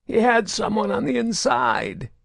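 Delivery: high, raw strained voice; raspy mutter. A narrator's reading. Grotto mutters to Saren, throat raw. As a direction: rasp it out exhausted. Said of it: "Grotto muttered".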